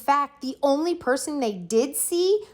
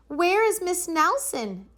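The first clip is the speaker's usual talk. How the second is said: put-on worried kids; 10 semitones higher than usual. A narrator's reading. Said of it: "asked the kids".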